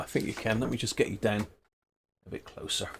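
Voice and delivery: ordinary talk, nothing marked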